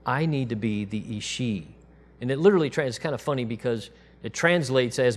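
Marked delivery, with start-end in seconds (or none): none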